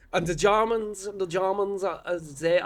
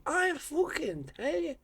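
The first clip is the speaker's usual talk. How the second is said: put-on drunk remark; 10 semitones higher, 4 dB quieter than usual. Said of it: "I was like".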